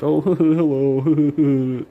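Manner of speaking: high, slow, loud laughing hello; stretched, laughing mock greeting. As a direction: drag out a fake-friendly hello through laughter.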